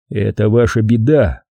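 ordinary delivery, nothing marked

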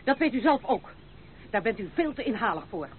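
loud, cutting accusation; angry rebuke. Acted. The lady hits back at the grasping grandmother, loud angry rebuke.

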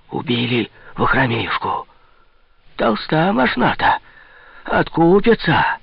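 high-pitched gossiping murmur rising from the back; hissing church gossip. A narrator's reading. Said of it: "В задах же шипели, перешептывались". (высокий голос шипящих сплетников)

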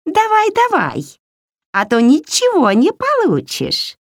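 high, far, nagging swamp hag; kikimora voice. A narrator's reading. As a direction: squeal it like a greedy old hag. Кикимора дразнит Ваську. (визгливый голос кикиморы)

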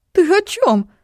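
loud high startled question; mother shrieks in surprise; high hysterical mother. Acted.

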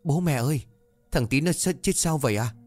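put-on a worried little boy asking his parents; child's voice. Little Cò asks his parents about Tí, anxious child voice.

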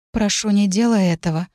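usual speaking voice

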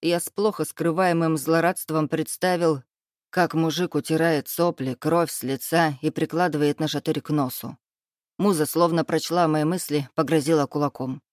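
usual speaking voice

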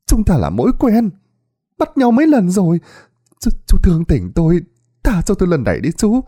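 wailing plea. Acted. Old woman clinging to the policeman's leg, begging in high tearful wails. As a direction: beg the officer like you are sobbing. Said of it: "bà là khóc lóc".

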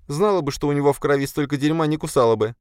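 high, quick, disgusted complaint; high-voiced spider grumbling; little spider creature. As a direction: chatter indignantly in a high creature voice.

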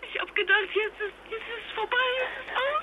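high, strained, the words crumbling; panicked voice breaking. Acted. Reliving drowning, breaking down.

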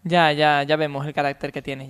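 normal talking voice

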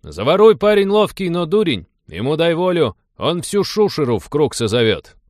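put-on cocky young Cossack taunting a newcomer; young Cossack mocking. A narrator's reading. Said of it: "воскликнул сидящий поодаль молодой казак".

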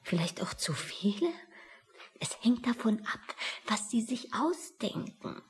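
hesitant whisper. Real, not acted. Secretary whispering nervously to Bitter. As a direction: stumble over it under your breath.

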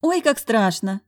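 sneering mockery. A narrator's reading. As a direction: tease him. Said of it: "съехидничала экономка".

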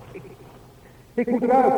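sudden high, loud cry of panic; desperate need to get out; panicked outburst. Acted. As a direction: burst out as the fear breaks through. Panic in the dark tunnel.

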